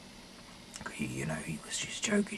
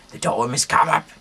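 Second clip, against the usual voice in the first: calling out; 8 semitones higher, 11 dB louder than usual. Acted. Delilah calls the Philistine lords.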